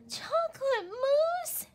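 high, slow whimper with a cry. Acted. Little wolf dreading dessert, high crying whimper. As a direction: whimper as if near tears. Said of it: "he whimpered".

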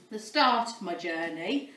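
ordinary talk, nothing marked

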